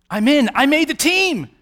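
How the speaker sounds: high, loud elated cry; jubilant, rising excitement; overjoyed. Acted. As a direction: exult as if just picked for the team. Thief overjoyed at entering heaven.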